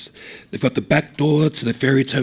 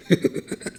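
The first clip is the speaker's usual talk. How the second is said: whispered laugh; 3 dB quieter than usual. Acted. Laugh after crazy.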